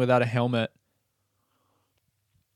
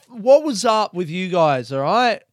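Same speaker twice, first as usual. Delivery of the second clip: mock indignant; 5 semitones higher than usual. Acted complaint to the store.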